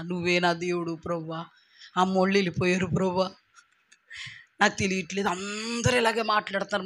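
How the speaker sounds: soft, low, broken pleading to God; tearful prayer. Retold or imitated. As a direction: pour out a quiet tearful prayer. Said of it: "ఏడవడం మొదలెట్టాను".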